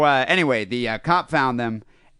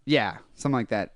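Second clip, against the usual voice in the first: usual speaking voice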